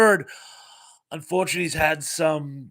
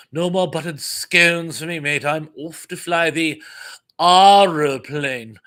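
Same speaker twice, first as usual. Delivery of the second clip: hammed-up posh English accent, stretched final word; Graham Chapman, posh English; 6 dB louder than usual. Teasing English restaurant customers, mock posh English accent. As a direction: tease them with a mock upper-class English accent. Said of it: "Graham Chapman thing".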